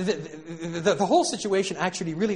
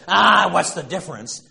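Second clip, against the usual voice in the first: mock Archie shout; 3 semitones higher, 11 dB louder than usual. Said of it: "when Archie Bunker says".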